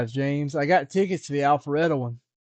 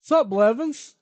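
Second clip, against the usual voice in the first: high, loud jokey greeting; a silly shouted hello; put-on greeting; 8 semitones higher, 4 dB louder, slower than usual. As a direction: call out in a goofy voice.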